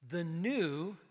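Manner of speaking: normal talking voice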